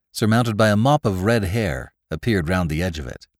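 plain reading voice

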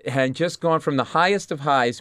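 ordinary talk

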